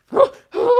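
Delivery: sharp, loud, high gasp; excited gasp. Real, not acted. Reader about to see both cards, excited gasp. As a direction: gasp with sudden delight.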